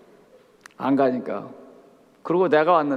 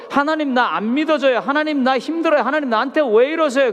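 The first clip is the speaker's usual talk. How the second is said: higher, imploring cries to God; pleading prayer; 7 semitones higher, 3 dB louder than usual. Retold or imitated. Struggling believers cry out to God in complaint, higher and imploring. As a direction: plead upward like a desperate believer.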